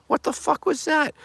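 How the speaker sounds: what the fuck, squeaking high with outrage; outraged disbelief. Real, not acted. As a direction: pitch leaps way up in indignant disbelief. Ranting about the debate.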